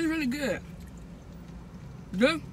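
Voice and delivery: talking while chewing; mouth full. Real, not acted. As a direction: between bites of burger. Seen 1: Repeatedly bites the burger and talks with cheeks full throughout.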